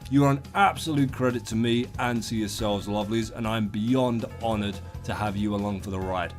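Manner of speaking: normal talking voice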